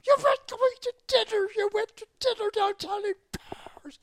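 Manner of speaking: high-pitched outraged yelling; furious shout. Retold or imitated. The colonel yells at the pilot about dining in Paris, high furious shout. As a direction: shriek it at him like an enraged colonel. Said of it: "he was so mad".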